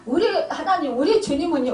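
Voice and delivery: ordinary delivery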